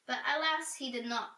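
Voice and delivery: ordinary delivery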